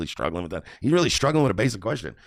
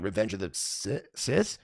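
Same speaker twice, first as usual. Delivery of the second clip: imitated hesitation; 5 dB quieter, slower than usual. Streamer granting Jeremy could stumble on the last word, imitated hesitation. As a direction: stall before SITH, then lift it into a question. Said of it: "I could understand Jeremy going".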